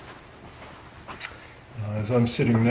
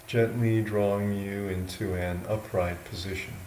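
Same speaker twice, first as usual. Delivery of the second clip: slow, unhurried guidance with long pauses between phrases; guided meditation; 2 semitones lower, 8 dB quieter than usual. Real, not acted. Monk guiding yogis into an upright sitting posture, slow meditation voice. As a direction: talk them gently into posture, a little softly, leaving space.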